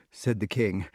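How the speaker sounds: plain reading voice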